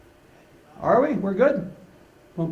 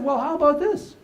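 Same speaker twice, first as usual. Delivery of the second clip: put-on little girl bargaining; 7 semitones higher than usual. Granddaughter voiced, bargaining.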